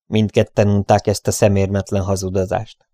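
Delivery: plain reading voice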